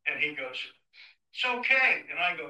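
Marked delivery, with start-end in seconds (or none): 1.3-2.0 pitched high and loud, breezy; high loud reassurance. Retold or imitated. Taxi driver telling the nervous sheriff, high and loud. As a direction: toss it off brightly, too loud.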